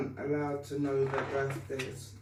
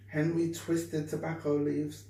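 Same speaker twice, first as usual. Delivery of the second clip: plain reading voice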